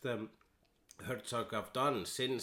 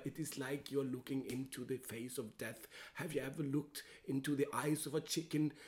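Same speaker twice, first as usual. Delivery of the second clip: Werner Herzog musing; 2 semitones higher than usual. Retold or imitated. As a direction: muse on death like Herzog. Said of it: "He was saying something like".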